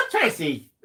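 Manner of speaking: ordinary delivery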